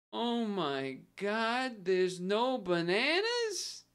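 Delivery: put-on high, drawn-out old-man cadence; slow old man. Streamer imitating an old guy at the store, slow old-man voice. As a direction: stretch every word out, high and incredulous. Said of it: "in this cadence, I can't do it, his old man voice".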